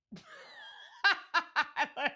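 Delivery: loud high cackle; real high laughing fit; burst of high laughter. Real, not acted. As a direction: crack up hard. Mom cracks up at daughter.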